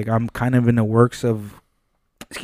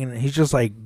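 usual speaking voice

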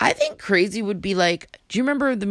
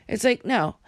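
ordinary delivery

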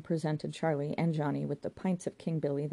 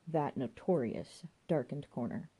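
"That notorious" drawn out slowly and weightily; slow emphasis; 2 semitones lower than usual. A narrator's reading. Narrator underlines the corner's reputation, slow weight on "notorious". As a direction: linger on "notorious". Second repeat, building the emphasis.

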